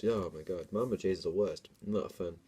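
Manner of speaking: ordinary talk, nothing marked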